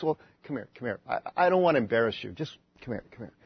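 very soft, low murmur; confidential aside. Acted. Peter not wanting to embarrass Jesus, hushed. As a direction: murmur it so nobody else overhears.

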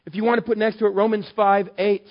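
high, loud impassioned preaching; preaching at full force. Acted. Pastor preaching to his church, high and loud with passion. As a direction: drive the grace message home to the congregation.

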